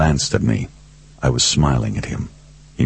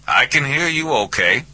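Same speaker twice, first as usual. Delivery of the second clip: higher Southern man on the line; high voice through a speakerphone; 11 semitones higher than usual. A narrator's reading. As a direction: answer easily down a tinny phone line. Hubble's voice over the speakerphone.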